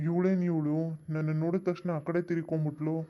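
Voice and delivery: usual speaking voice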